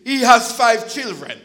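loud quote through a laugh; Carol's punchline said with a loud laugh. Retold or imitated. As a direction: deliver her line laughing loudly. Carol's motherly reply.

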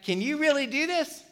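lightly voiced Jesus asking; Jesus questioning. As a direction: ask it like a skeptical teacher. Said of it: "Jesus is like".

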